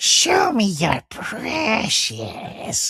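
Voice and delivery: slow, breathy whispered creature hiss; Gollum voice. Retold or imitated. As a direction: creep it out like Gollum. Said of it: "my Gollum voices".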